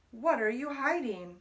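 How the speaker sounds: usual speaking voice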